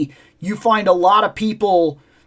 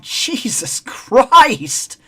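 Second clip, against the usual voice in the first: exasperated groan; 4 semitones higher, much slower than usual. Retold or imitated.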